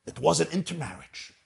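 ordinary talk, nothing marked

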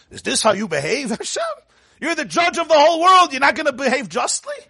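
high-pitched, incredulous challenge; indignant protest. Acted. Abraham challenging God as judge of the world, indignant protest. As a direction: question the judge in high, acted outrage. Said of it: "the greatest believers were the one who protested".

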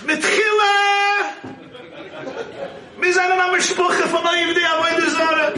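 booming in-law's speech toast; boastful toast shout. Acted. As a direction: announce the family lineage like a proud father-in-law. Proud in-law's toast at Ufruf.

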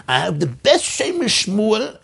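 high, drawn-out imitation of a rabbi's accent; Rabbi Grunfeld's classic accent. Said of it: "in his classic accent".

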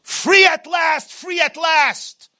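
high, slow shouted cry of freedom; jubilant cry. Acted. Freed slaves crying out at liberation, slow triumphant shout. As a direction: stretch it out triumphant.

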